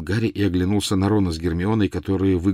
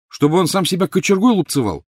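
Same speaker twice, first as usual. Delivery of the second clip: high, indignant retort; scornful snort; 10 semitones higher, 5 dB louder than usual. A narrator's reading. Boy rejecting his friend's plea, scornful. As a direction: toss back the suggestion with scorn. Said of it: "фыркнул Гарри".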